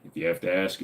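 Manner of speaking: ordinary talk, nothing marked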